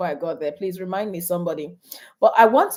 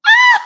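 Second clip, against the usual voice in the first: high laughing scream of embarrassment; real embarrassed shriek; 14 dB louder than usual. Real, not acted. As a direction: shriek in mock horror. Embarrassed at kids' teasing.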